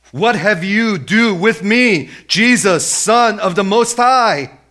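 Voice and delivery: high, drawn-out demon's cry; booming, high-pitched plea to Jesus; loud crying out. Acted. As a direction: cry it out at full voice, stretching each phrase. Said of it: "he cries out with a loud voice".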